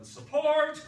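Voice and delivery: a sudden loud, high burst; sudden shout. Retold or imitated. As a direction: shout the word out in triumph. Sudden burst of teacher enthusiasm.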